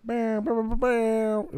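high chanted bam bams; slow, high mock drumbeat; sound effect imitation.

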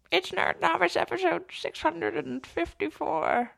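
put-on high, soft old lady voice; creaky old granny bit. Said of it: "You do a good old lady voice".

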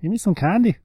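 high put-on Canadian voice; attempted Canadian accent. Host trying out Canadian, high mock Canadian accent. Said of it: "when I try to do Canadian".

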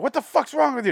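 high-pitched imitation of panicked outrage; imitated freak-out. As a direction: act out someone freaking out. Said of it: "the guy freaks out".

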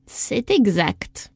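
slow, calm approval; calm priestess. A narrator's reading. Priestess confirming the answer to Isadora, slow and calm.

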